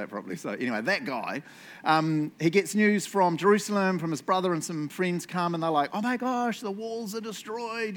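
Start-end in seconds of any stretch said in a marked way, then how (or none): 5.9-8.0 higher-pitched imitation of alarmed messengers; mock panic. Friends bring bad news from Jerusalem, mock alarm. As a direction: lightly act out a freak-out, voice lifted.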